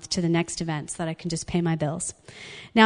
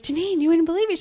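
high, excited imitation of a friend; totally excited; 10 semitones higher, faster than usual. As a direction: burst in with thrilling news, pitched up. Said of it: "she's com-, totally excited".

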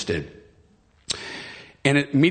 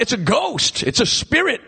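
high-pitched cry of panicked disciples; mock terrified yell, pitched way up; imitated scream; 12 semitones higher than usual. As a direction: act out a startled, strained scream. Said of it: "they screamed out".

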